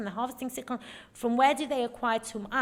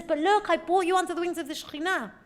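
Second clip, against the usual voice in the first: pleading; 6 semitones higher, 5 dB louder than usual. Retold or imitated. Rabbi trying to make amends.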